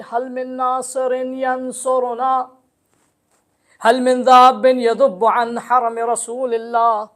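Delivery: sustained, level-toned lament; mournful recitation. Acted. Preacher recites Imam Hussain's call for help, mournful chanting tone.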